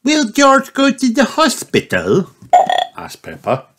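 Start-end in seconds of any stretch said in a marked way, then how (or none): 0.0-2.3 put-on high child voice asking; little girl voice. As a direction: voice a small piggy girl. Peppa voiced in story.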